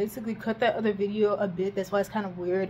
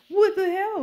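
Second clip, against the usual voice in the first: incredulous; 12 semitones higher than usual. Real, not acted. Baffled at Peter's choice.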